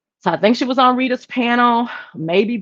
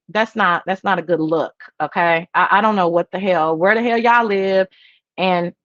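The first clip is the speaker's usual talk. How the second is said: normal talking voice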